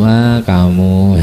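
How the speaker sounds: slow, shy, smiling "ah, kamu"; coy smiling. Retold or imitated. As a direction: say it bashfully with a smile. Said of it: "Terus senyum". (malu-malu sambil tersenyum)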